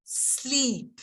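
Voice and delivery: smiling demo of Sleep; exaggerated sleep. Shown as a demonstration. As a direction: stretch the ee in Sleep. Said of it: "can we say sleep".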